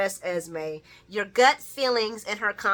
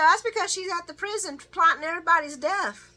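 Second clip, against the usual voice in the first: excited gossip; 9 semitones higher, 4 dB louder, faster than usual. Retold or imitated. Gossiping punchline, raised pitch.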